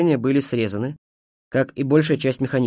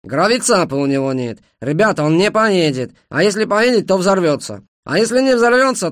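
loud, higher-pitched indignant tirade; flaring up; 6 semitones higher, 5 dB louder than usual. A narrator's reading. Ashot rants at his friends about the railcar, worked up. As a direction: rail against the whole scheme. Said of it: "взвился Ашот".

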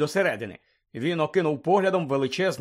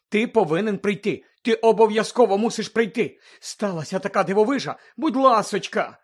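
higher-pitched, insistent begging; urgent pleading; 5 semitones higher, 4 dB louder than usual. Acted. Hermione begging Harry to come see a marvel, urgent pleading. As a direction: beg him urgently to come.

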